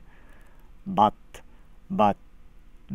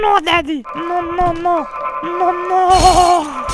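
put-on higher, louder pleading child; whiny little kid; 6 semitones higher, 6 dB louder than usual. Child begging daddy not to make him go, whining. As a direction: wail no like a kid being dragged off. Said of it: "I've actually heard little kids talk like that before".

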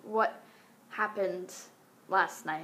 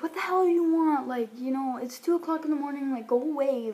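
raised, higher-pitched mock scolding; irritated boyfriend; 5 semitones higher, 5 dB louder than usual. Boyfriend to stranger banging at two a.m., imitated irritation. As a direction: act out a fed-up boyfriend sending someone away.

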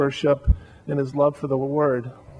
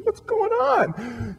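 drawn-out, much higher, choked-up wail; slow high weepy cry; tearful cry; much slower than usual. Retold or imitated. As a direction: wail it slowly as if crying. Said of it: "I would just start crying".

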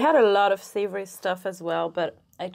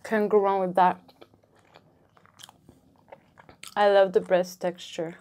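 chewing between words; talking while chewing. Real, not acted. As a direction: mouth partly full. Seen 1: Holds bitten roll, lips pressed, cheeks slightly full while talking.

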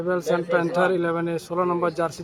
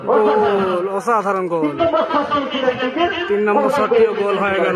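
goal call; 3 semitones higher, 3 dB louder than usual. Real, not acted. Third goal scored.

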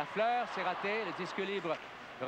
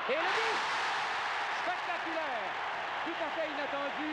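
excited sports commentary; 6 semitones higher than usual. Real, not acted. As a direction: shout the goal, then marvel at it. Surprise goal on play-by-play.

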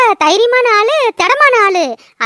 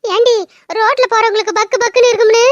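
ordinary delivery

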